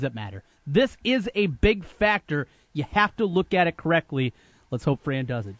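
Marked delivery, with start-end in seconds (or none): 4.7-5.6 winded, short of air; out of breath. Real, not acted. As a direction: talk while out of breath. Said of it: "Jim, I'm out of breath".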